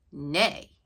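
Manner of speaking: a loud, proud nay. Acted. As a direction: neigh it out loud like a pony. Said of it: "See her saying nay really loud?".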